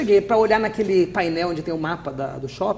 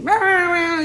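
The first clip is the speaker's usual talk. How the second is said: put-on boy calling out; 9 semitones higher, 5 dB louder than usual. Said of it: "veio um menininho pra mim e falou assim".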